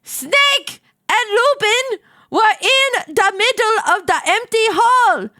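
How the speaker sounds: high, excited reading. Real, not acted. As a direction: read it like a big reveal. Climax read out in excitement.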